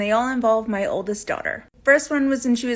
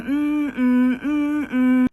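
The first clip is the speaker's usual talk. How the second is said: creepy humming; 4 semitones higher, 3 dB louder, much slower than usual. Retold or imitated. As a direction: hum it slowly like a child rocking herself. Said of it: "rocking back and forth in her room going".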